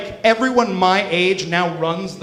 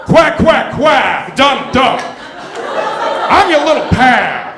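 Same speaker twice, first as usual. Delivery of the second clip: loud, higher Pacino yelling as a duck; Al Pacino as Donald Duck; 6 semitones higher, 5 dB louder, much slower than usual. Pretend. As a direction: bellow like Pacino playing a cartoon duck, ending in a shout. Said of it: "Al Pacino as Donald Duck".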